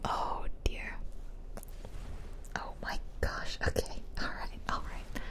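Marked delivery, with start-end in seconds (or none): none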